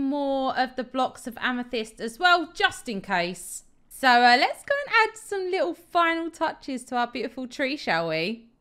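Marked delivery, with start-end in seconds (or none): none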